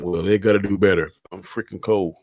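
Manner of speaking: ordinary delivery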